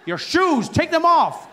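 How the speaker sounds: loud, high insistent command; airport officer's order. Retold or imitated. Security officer to confused traveller, insistent shout. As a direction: repeat it forcefully for the clueless. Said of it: "but they don't care".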